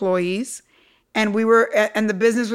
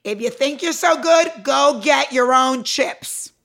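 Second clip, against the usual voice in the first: stern boss; 4 semitones higher, slower than usual. Retold or imitated. Boss scolds crying young employee.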